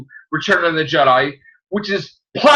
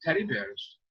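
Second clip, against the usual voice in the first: much softer, low dazed mumble; stunned murmur; 4 semitones lower, 16 dB quieter than usual. Retold or imitated. Kid answering father softly, dazed and low. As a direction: mumble it in a daze. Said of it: "And I'm like".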